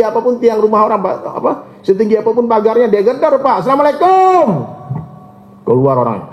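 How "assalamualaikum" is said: high called-out greeting at the door; calling greeting. Retold or imitated. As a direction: call it out to someone inside. Tabligh worker knocking at door.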